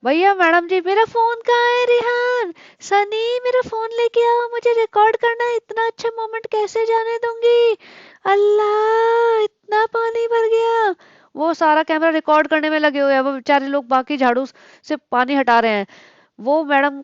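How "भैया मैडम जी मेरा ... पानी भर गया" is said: much higher put-on voice, stretching out Allah slowly; mock excited vlogger. Mocking the vlogger calling for her phone to record the flood, high excited mock voice. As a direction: ham up a girl thrilled to film a disaster.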